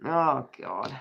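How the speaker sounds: normal talking voice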